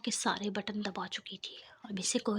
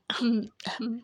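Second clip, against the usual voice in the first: two pointed throat clears; throat clearing; 4 dB louder, slower than usual. A narrator's reading. Boss behind them clears his throat pointedly. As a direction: clear the throat to announce yourself. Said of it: "زاویار کی آواز آئی".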